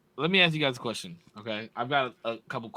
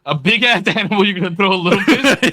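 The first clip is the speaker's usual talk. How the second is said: laughing; 5 semitones higher, 11 dB louder than usual. Real, not acted. Laughing in disbelief at the idea.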